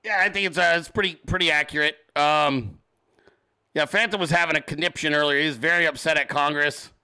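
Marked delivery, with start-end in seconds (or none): none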